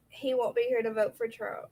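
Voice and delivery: ordinary talk, nothing marked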